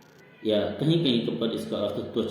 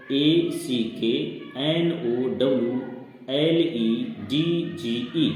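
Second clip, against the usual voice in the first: spelled out letter by letter; much slower than usual. Shown as a demonstration. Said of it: "जैसे spelling इसकी होती है".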